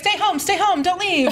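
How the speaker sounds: put-on Jamaican accent. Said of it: "but in a Jamaican accent".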